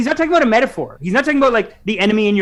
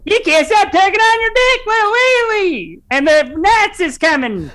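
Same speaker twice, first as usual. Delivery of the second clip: drill sergeant yell; 11 semitones higher than usual. Retold or imitated. Imitating the Full Metal Jacket soldier, yelling at recruits. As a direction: bark it like a drill sergeant in boot camp. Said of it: "he's just going crazy".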